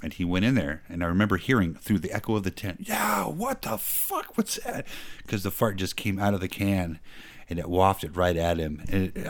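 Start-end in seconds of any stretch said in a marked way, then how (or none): 2.8-4.8 much higher, slow and alarmed; high disgusted surprise. Retold or imitated. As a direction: recoil at a sudden stink. Soldier smells the can.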